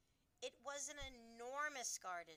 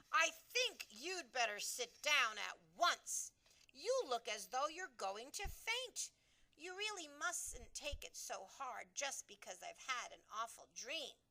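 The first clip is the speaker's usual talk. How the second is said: louder, firmer royal command; sharp; 4 semitones higher, 8 dB louder than usual. A narrator's reading. Queen ordering the shaken maid to sit down. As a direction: order her to sit, firmly. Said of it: "rather sharply".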